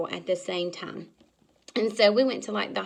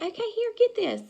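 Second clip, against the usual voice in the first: chirpy hand-off; 12 semitones higher than usual. Retold or imitated. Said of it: "And I said".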